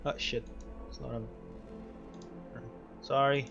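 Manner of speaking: normal talking voice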